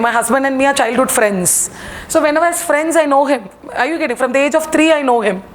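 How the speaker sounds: ordinary talk, nothing marked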